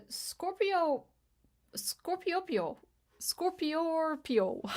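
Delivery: slow high teasing sing-song on the name; playful name mangling. Pretend. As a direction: stretch the name slowly, teasing.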